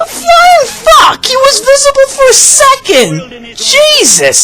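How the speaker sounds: shocked outburst. Real, not acted. Reviewer yelling at the lazy editing in real shock. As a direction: blurt it out like you can't believe your eyes.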